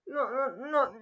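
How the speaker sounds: ordinary talk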